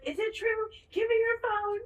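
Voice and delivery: high-pitched mock yell; retold yelling. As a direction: imitate a frantic yelled demand. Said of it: "Evan's yelling".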